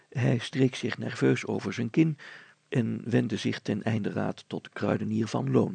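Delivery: ordinary delivery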